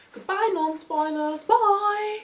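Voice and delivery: high, drawn-out cheery send-off; playful farewell. Pretend. Reviewer shooing away spoiler-free viewers, playful high farewell. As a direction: wave them off in a high, lilting goodbye. Sending off viewers who haven't read it.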